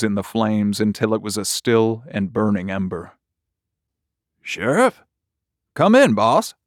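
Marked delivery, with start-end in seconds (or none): 4.4-6.5 higher-pitched voice calling the boss; high, rising, concerned dispatcher; radio dispatcher. Acted. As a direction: call questioningly over the radio. Dispatcher answers sheriff over radio.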